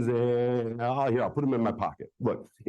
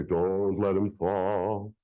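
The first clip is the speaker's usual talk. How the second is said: slow warning; 5 semitones lower, much slower than usual. Acted. Instructs volunteer with ball.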